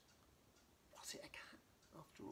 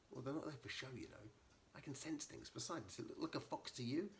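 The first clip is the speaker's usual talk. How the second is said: an indignant, higher-pitched retort; understated annoyance, still piqued; mild anger; 6 semitones higher than usual. Acted. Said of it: "she said, angrily".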